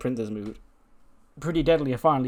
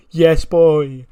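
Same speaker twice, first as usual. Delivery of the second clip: loud, higher celebratory yell; triumphant reunion shout; 5 semitones higher, 13 dB louder than usual. Retold or imitated. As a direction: belt out the catchphrase to a long-lost partner. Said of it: "he opens his arms and he goes".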